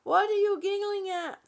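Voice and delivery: husband demanding to know, lightly mimicked; imitating husband. Husband asks her what the giggling is about, mock-questioning. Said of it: "My husband said".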